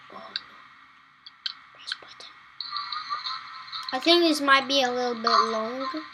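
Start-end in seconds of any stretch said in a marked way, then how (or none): 0.1-2.3 whispered aside, no voice. Real, not acted. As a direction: breathe it out quietly. Unvoiced aside.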